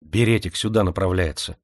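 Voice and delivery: usual speaking voice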